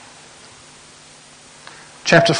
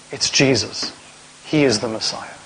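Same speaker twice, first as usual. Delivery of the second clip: normal talking voice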